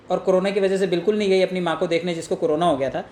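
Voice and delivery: normal talking voice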